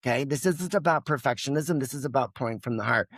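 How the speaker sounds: ordinary talk, nothing marked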